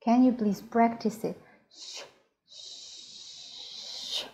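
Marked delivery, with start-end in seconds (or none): 1.7-4.2 very soft, voiceless "sh", the last one held; whistled sh. Shown as a demonstration. Teacher asking learners to practise, whistled "sh". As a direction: let "sh" hiss out like a whistle. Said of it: "you have to try to whistle it".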